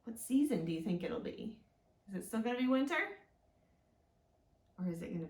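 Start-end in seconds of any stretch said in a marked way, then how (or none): none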